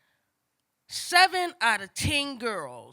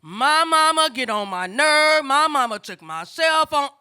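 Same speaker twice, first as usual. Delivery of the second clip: mimicked teen complaint; 3 semitones higher, 7 dB louder than usual. Schoolgirls gripe about their mamas, loud mimicked complaint. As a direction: imitate griping kids, slow and loud.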